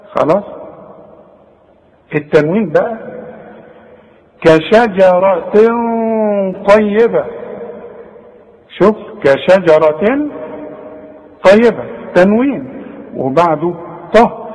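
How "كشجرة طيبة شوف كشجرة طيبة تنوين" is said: كشجرة طيبة with tanween hidden and nasal before ط; slow demo. Shown as a demonstration. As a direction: draw out كشجرة طيبة with a hum.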